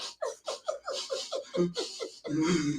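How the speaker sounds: high, quiet imitated sniffling sobs; mock sobbing. Acting himself as a punished child in his room, mock sobbing. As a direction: whimper in little hiccuping gasps, played for laughs. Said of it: "I had to sit there like".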